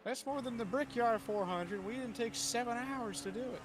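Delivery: oversell it like a race promo; hammed-up hype voice. Mock hype about race distance.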